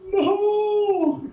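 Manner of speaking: high dramatic cry of No; hammed-up despair, down on knees; mock wail; mock anguished wail. Mock wail kneeling at cops.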